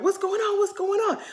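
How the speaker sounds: mock panic. Cast reacting to the article.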